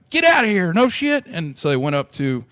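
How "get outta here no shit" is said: much higher, louder squeal of disbelief; a delighted stranger who can't believe it; stunned old lady. Retold or imitated. Lady at the bar hearing about the birthday, high astonished squeal. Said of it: "And she's like".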